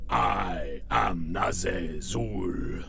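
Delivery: slow, breathy monster announcer; best radio voice. Shown as a demonstration. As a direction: drag it out low and slow like a villain. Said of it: "my best radio voice".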